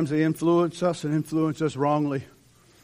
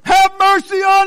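much higher, louder plea; desperate cry; 16 dB louder than usual. Retold or imitated. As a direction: cry out even louder for mercy. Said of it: "cried out even more, even louder".

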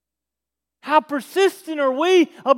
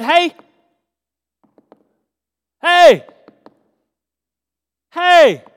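calling out; 3 semitones higher, 9 dB louder, much slower than usual. Acted. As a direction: holler up to a window, waiting between calls. Man calling up at night.

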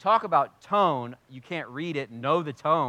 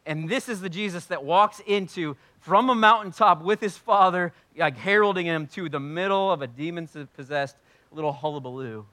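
usual speaking voice

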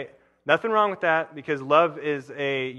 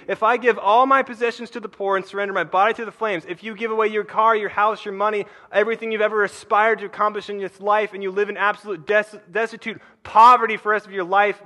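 higher, louder, pressing on; fiery paraphrase; 6 semitones higher, 5 dB louder than usual. Shown as a demonstration. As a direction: preach it with rising heat. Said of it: "this is what that voice says in my head".

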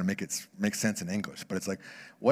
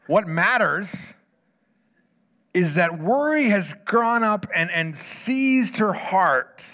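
higher, louder, slowed emphasis; preaching with force; 6 semitones higher, 5 dB louder, slower than usual. Acted. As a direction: drive each phrase home slowly and loud. Preaching point on worry.

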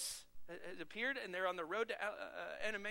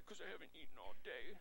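mopey low dog voice, a bit softer; Frank voice; 3 semitones lower, 6 dB quieter than usual. Retold or imitated. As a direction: whine it as the hungry basset hound. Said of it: "plays a great Frank voice".

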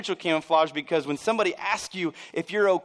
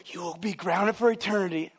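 imitated stern mom threat; scolding mom; 2 semitones higher than usual. Mom grounding the kids, scolding. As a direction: lay down the law like a cross mother. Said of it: "learn how to go from".

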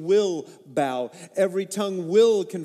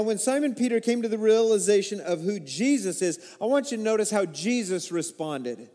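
ordinary talk

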